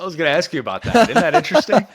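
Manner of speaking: eager, delighted interruption; excited. Real, not acted. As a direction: jump in fast with rising excitement.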